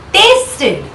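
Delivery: ordinary talk, nothing marked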